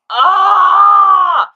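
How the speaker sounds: loud, high, drawn-out "aah" of disbelief; long, loud cry of shock; shocked scream. Real, not acted. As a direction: scream in dismay at the passing years. Shocked at how long ago.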